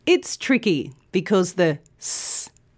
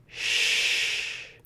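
long, soft shh; sustained sh sound; 7 dB quieter than usual. Shown as a demonstration. Teacher shows learners the sh sound, soft and long. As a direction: round the lips and blow a long sh. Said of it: "make this sound by rounding your mouth".

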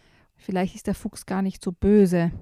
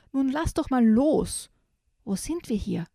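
astonished; 5 semitones higher than usual. Acted. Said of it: "fragt Zipfel erstaunt".

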